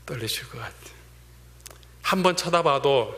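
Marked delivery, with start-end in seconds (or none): 0.1-1.0 soft unvoiced whisper. Real, not acted. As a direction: hush it as if sharing a secret. Preacher whispers intimately. (속삭이듯 조용히)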